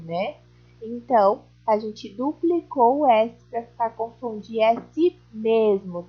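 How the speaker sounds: ordinary delivery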